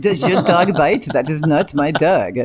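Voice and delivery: slow mock French accent; drawn-out comic French accent, film-quote parody; Inspector Clouseau. Host quoting a film for the guest, mock French accent. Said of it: "Inspector Clouseau?".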